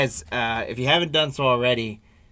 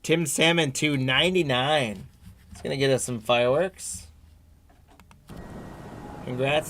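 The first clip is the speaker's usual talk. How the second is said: ordinary talk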